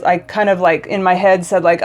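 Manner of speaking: ordinary talk, nothing marked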